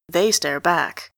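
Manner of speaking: usual speaking voice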